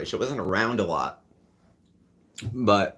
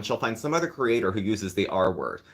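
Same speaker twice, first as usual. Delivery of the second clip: ordinary talk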